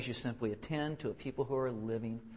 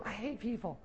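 higher but softer retort; mock grumbling spouse; 8 semitones higher, 6 dB quieter than usual. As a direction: grumble back at the keen host. Acts inhospitable spouse.

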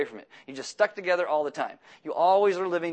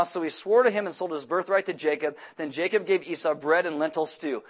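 ordinary talk

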